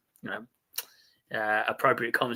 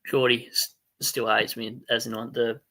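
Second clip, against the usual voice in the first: ordinary talk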